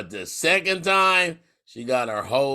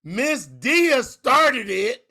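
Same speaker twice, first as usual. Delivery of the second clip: laughing; 8 semitones higher, slower than usual. Real, not acted. Retelling school trouble, laughing.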